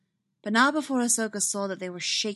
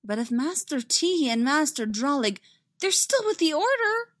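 raised, louder, insistent objection; protesting; 8 semitones higher, 4 dB louder than usual. A narrator's reading. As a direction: pitch climbing high in protest. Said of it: "Ahsoka protested".

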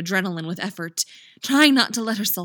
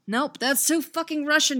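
higher-pitched, exasperated outburst; exasperated self-correction; 8 semitones higher, 4 dB louder than usual. Real, not acted. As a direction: cut yourself off in loud-ish, high exasperation. Outtake, exclaiming over botched accent.

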